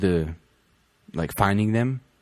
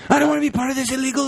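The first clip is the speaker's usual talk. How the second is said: high, fast hammed-up freak-out; mock panic; 4 dB louder, faster than usual. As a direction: squeal it quickly in pretend alarm. Mock panic over illegal songs.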